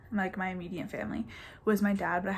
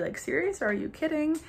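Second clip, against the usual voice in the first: mock disbelief; 8 semitones higher than usual. Parents' disbelief retold.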